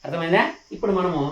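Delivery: usual speaking voice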